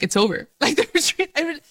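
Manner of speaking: high, exasperated mock despair over a tiny mishap; mock panic. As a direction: act out your own silly meltdown, pitched high. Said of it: "I was like".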